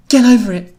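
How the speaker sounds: high, loud, firm order. A narrator's reading. Louise to the two ninjas, firm. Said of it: "She was very firm as she spoke".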